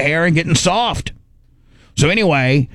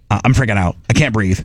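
usual speaking voice

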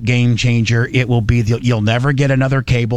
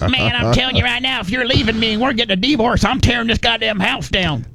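mock defiance; 9 semitones higher than usual. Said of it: "his own mock threat to a wife".

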